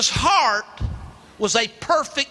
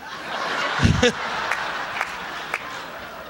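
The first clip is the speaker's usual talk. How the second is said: quiet airy chuckle; breathy laugh; 6 dB quieter than usual. Real, not acted. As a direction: laugh softly under the breath. Laughs at getting fewest votes.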